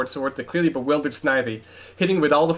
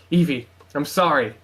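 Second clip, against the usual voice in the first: ordinary delivery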